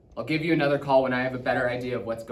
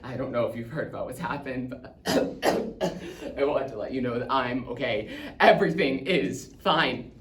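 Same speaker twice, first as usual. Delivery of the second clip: louder, halting speech broken by coughs; coughing, strained reassurance; 5 dB louder than usual. Acted. Dad phoning home as it gets smoky, strained. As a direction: insist all is fine through the smoke.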